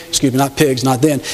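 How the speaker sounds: ordinary talk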